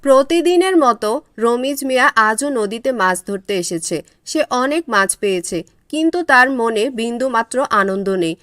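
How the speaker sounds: low, even storyteller voice; narrating a village folk tale; story narration. A narrator's reading. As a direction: tell the tale calmly to listeners. Narration of fisherman's day.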